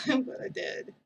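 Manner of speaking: breathy laugh through the words. Real, not acted. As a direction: laugh it out, embarrassed. Admits she enjoyed it.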